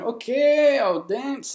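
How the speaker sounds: high, uneasy okay; mock-nervous consent; reluctant agreement. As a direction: give in awkwardly, pitch jumping up. Retold reluctant giving in.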